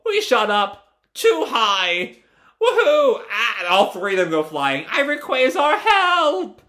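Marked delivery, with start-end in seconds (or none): 0.1-3.2 drawn-out, high airborne cry; whooping in flight. Acted. Characters shoot up into the sky. 4.9-6.6 high, drawn-out mock wail; wailing for help. Flying scientist cries for help.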